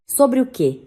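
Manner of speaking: cold, curt question; icy retort; coldly. Acted. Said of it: "perguntou minha mãe friamente".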